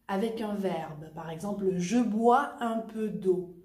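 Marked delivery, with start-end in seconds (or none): 1.8-3.5 "je bois un peu d'eau" slow, "bois" and "un" kept apart; no liaison. Shown as a demonstration. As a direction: break cleanly after "bois". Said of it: "no hago la liaison".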